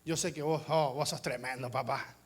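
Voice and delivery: butter up the father; flattering son. Retold or imitated. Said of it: "viene mi hijo y me dice".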